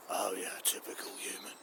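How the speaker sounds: a voiceless, slowed bitter whisper; resentful whisper. Acted. The dog Rover sneers at Dave the human, whispered. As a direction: breathe out the grudge.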